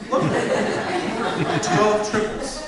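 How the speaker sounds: hammed-up wonder at trivia; mock amazement. Punchline of baseball trivia.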